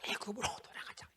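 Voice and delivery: hushed whispered line; softly whispered scripture. Real, not acted. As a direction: whisper it like a secret plan.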